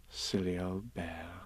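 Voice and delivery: low, very soft, slow and tender; loving. A narrator's reading. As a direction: say it softly and fondly. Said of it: "in such a loving voice".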